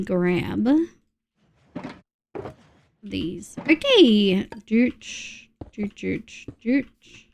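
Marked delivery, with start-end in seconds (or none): 4.7-7.2 quiet little placing sound effects; soft "dooch" noises. Real, not acted. Streamer busy building on screen, soft self-made sound effects. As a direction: murmur a sound effect to yourself.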